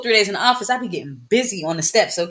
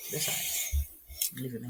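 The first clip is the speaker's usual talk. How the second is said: quiet aside; 7 semitones lower, 17 dB quieter, slower than usual. Real, not acted. Muttered aside over the buzz.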